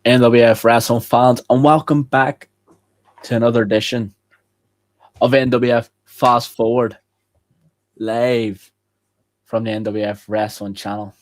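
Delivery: loud, projected wrestling host intro; show opener announcer. Acted. Host welcomes wrestling fans back to the show, loud announcer.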